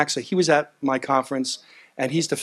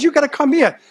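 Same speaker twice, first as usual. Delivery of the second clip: exasperated retort; 11 semitones higher, 4 dB louder than usual. Retold or imitated. Arguing with border officials.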